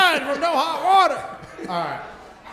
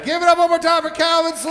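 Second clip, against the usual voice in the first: announcer; 5 semitones higher than usual. Acted. Host introduces comedian.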